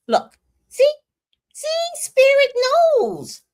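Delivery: very high, loud exulting; ecstatic shout. Acted. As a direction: cry it out in triumph. Ecstatic claim of spirit.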